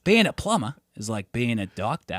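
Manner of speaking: soft, lightly put-on Australian accent. Mate repeating the plumber's line from memory, Australian accent. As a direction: repeat it like the Aussie guy did. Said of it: "your Australian accent got really good".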